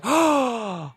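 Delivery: sharp gasp, then a high "oh"; delighted gasp. Retold or imitated. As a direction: gasp as if you just found the perfect costume. Dubbing his avatar, gasps with delight.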